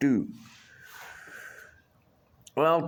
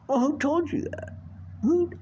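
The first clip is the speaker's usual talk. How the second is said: much higher, rising into a near-yelp on who; mock incredulous. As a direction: play the innocent, shocked. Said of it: "And I'm like".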